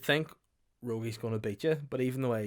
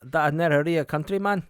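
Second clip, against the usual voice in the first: loud, higher brusque cafe worker voice; cafe lady; 6 semitones higher, 8 dB louder than usual. Retold or imitated. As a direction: bark it like a gruff Edinburgh cafe lady.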